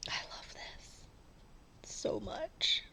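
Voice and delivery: breathy whispered gush of love; soft whisper, adoring. Real, not acted. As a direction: whisper it like a secret delight. Fond aside to the needle minder.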